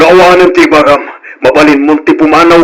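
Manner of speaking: indignant father shouting at the gossiping neighbor; angry order to leave. Acted.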